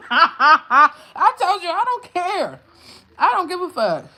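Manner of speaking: high, loud and laughing, brushing it off; laughing I don't care; laughing defiance. Real, not acted. Laughing off the trolls.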